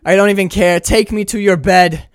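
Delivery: loud, high, hammed-up surrender; big melodramatic outburst; swept-away movie lover. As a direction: throw it out in mock abandon.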